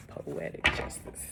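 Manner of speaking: slow, hushed whisper. Real, not acted. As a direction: whisper it slowly to yourself. Echoes the song title, whispered.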